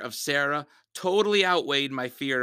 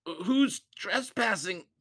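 scared; 7 semitones higher, slower than usual. Acted. Terrified kid facing monster.

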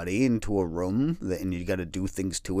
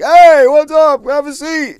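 much louder and higher, slow and booming; loud cheery receptionist; 16 dB louder than usual. Retold or imitated. Sleep clinic staff greeting patients, in a loud, high, booming voice. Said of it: "sleep study clinics where they were like".

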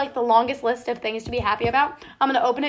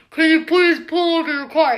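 louder, raised-pitch mock cop voice; pretend police officer; 4 semitones higher, 3 dB louder than usual. Imagining being police pulling a car over, mock officer voice. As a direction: play a cop ordering a driver over. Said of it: "imagine if you're a police and you're like".

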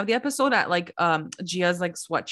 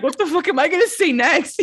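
higher, louder, laughing panic; mock stumped Joe Gorga; 7 semitones higher, 5 dB louder than usual. Joe Gorga on the bench, panicking about topping the answer, louder and higher. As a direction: play it like a guy frantically searching for words.

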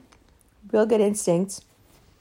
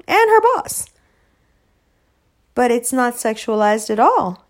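normal talking voice

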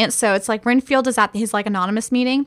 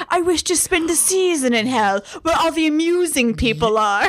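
higher-pitched, theatrical vampire impression; Dracula; 5 semitones higher than usual. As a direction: declaim it like a mock-grand villain. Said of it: "they're telling, like, Dracula".

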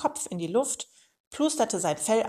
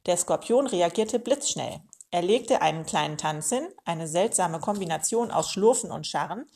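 usual speaking voice

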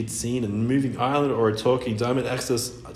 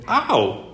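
sharp cry of pain; 9 semitones higher, 4 dB louder than usual. Acted. Mermaid hurt cry.

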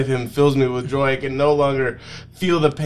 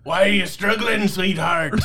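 higher, pushed pirate growl; hammed-up pirate voice; pirate-ish Shrek; 6 semitones higher than usual. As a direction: ogre try that lands as a pirate. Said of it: "That's like a pirate".

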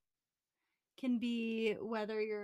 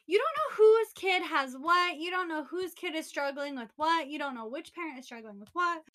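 loud rant; 6 semitones higher, 11 dB louder than usual. Real, not acted. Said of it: "ranting about judgmental strangers".

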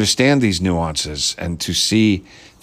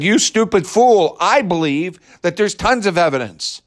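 mocking the tweeter; 8 semitones higher than usual. Vlogger mocking a tweeter's opinion, high sneering voice. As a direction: sneer the tweet back at him in a raised voice. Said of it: "If he had said".

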